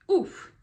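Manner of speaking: a sharp high oof of real emotion; moved oof. Real, not acted. After reading therapist's quote aloud, she lets out a high moved oof. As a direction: let the oof jump up, caught by the feeling. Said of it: "I literally got chills just saying it".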